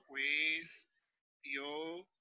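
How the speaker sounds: CUESTIONARIO split slowly: cues, tio, na, rio; very slow syllable division of "Cuestionario". Shown as a demonstration. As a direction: say "Cuestionario" in stretched syllables with gaps. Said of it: "De esa forma debiste separar".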